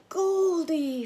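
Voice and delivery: very high, loud, delighted cry; boy sees his lost fish, crying out in delight; joyful exclaim. A narrator's reading. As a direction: shout with sheer joy. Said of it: "Franklin exclaimed".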